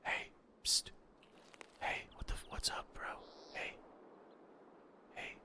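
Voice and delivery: hushed whisper to get attention; whisper, trying to get noticed; psst for attention. Pretend. Sneaking up whispering to someone.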